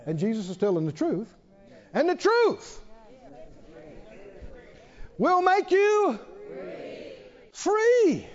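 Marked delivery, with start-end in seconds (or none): 1.9-8.3 soaring proclamation. Acted. Preacher proclaiming truth to congregation, high and ringing. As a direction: lift it up to the rafters.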